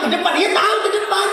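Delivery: high exasperated jab; mock the pointless answer; annoyed wife mocking the husband.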